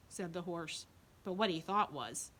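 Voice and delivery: plain reading voice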